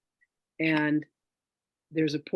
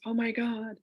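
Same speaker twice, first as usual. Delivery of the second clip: high, thrilled gush ending in a whisper; excited; 7 semitones higher than usual. Retold or imitated. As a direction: gush like an excited friend sharing news. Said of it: "and she goes".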